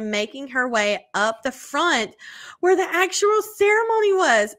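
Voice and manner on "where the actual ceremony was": excited. Acted. Reading shocking email part.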